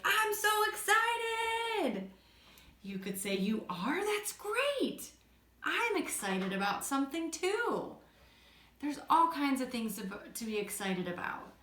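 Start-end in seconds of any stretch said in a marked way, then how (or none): 0.0-2.1 high, drawn-out burst of excitement; excited. Acted. Teacher acts someone in the yellow zone, excited. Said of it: "And they could say". 3.3-8.0 high-pitched, delighted response; excited reply. Shown as a demonstration. Teacher models answering an excited friend, excited.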